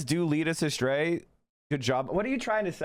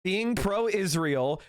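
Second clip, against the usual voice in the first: normal talking voice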